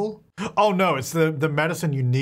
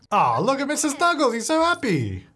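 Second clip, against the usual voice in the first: delighted; 10 semitones higher than usual. Real, not acted. Pet is happy now.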